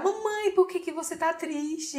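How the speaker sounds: a small daughter asking why mum is sad; worried little girl. Retold or imitated. Voicing daughter again. (voz de criança preocupada, baixinho)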